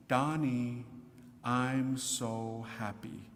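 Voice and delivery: a hint of the gentle heavenly voice; tender whisper of Mary. Voicing the female voice that called him Donnie, softly and slowly. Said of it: "it said to me".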